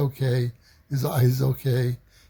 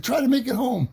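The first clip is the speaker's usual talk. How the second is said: much higher excited yell; excited cheer; 11 semitones higher than usual. Retold or imitated. Kids urge the batter to run home, excited high cheer. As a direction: egg the runner home, pitch soaring.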